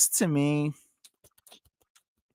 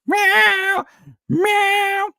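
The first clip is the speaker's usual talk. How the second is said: high cat meows; 7 dB louder than usual. Pretend.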